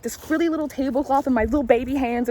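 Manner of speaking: ordinary talk, nothing marked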